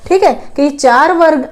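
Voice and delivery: ordinary delivery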